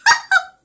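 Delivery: witch cackle. Pretend. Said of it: "I will work my witch luck now".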